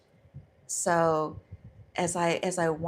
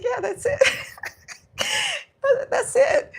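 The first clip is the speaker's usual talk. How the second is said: much higher, loud laughing voice; laughing hard; 6 dB louder than usual. Real, not acted. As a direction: squeal it out through helpless laughter. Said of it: "I'm in tears 'cause it was so funny".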